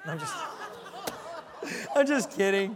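high laugh into loud retraction; real laugh, just kidding. Real, not acted. Laughs after dark joke.

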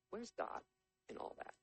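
very soft, halting question; hushed lament. Acted. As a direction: let the question sink to a near-murmur with a pause. Joseph's repeated lament from prison.

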